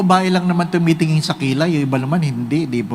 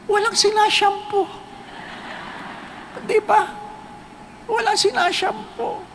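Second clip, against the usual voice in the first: comic high voice; 13 semitones higher, 7 dB quieter than usual. Acted. Joke punchline in high voice.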